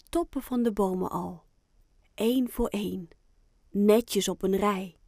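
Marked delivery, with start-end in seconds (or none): none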